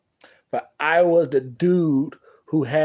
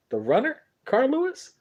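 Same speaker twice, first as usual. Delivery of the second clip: incredulous; 11 semitones higher than usual. Retold or imitated. He can't believe it.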